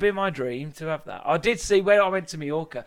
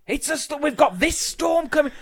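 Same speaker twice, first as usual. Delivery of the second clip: mock alarm; 10 semitones higher, 5 dB louder than usual. Mocking people panicking about storms.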